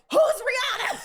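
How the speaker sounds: very high, loud, bewildered yell; mom shouting. Retold or imitated. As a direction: scream the question like an outraged mom.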